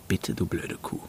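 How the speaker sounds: muttered insult. Acted. Josh insulting his ex to himself, whispered. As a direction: spit it out quietly to himself.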